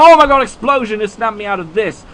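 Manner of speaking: sudden high, loud outburst; startled shout. Real, not acted. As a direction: yell as if jolted awake by a blast. Startled by explosion in battle.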